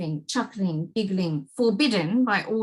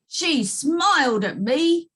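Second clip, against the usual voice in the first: mild soldier report; 6 semitones higher, 4 dB louder, slower than usual. Acted. Soldier reporting to weeping king.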